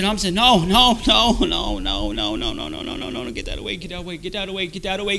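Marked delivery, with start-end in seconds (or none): 0.3-2.4 raised, repeated refusals; tormented refusal. Retold or imitated. Tormented man refusing the voices in his head, distressed. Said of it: "I'm there being tormented". 2.4-5.2 rapid, softer frantic chant; tormented muttering. Retold or imitated. Tormented man pushing the voices away, frantic and hushed. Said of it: "I'm there being tormented".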